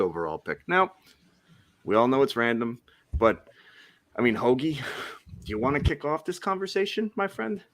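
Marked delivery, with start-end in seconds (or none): none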